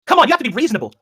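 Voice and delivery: real heated plea to be reasonable. Real, not acted. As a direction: protest with your pitch shooting up. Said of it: "I, I raised my voice".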